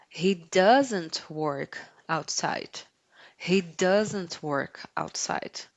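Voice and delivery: slow, clear teacher model; slow model sentence. Shown as a demonstration. Teacher slowly models the sentence for listeners to repeat. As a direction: say each word slowly for students to repeat. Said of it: "Repete comigo".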